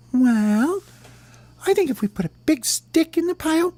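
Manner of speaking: high falsetto worm voice, dipping and rising; scheming little worm. Acted. Worm tells bird his plan to scare birds, squeaky voice. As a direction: lay out the plan in a tiny voice.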